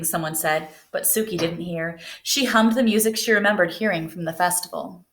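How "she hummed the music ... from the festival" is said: plain reading voice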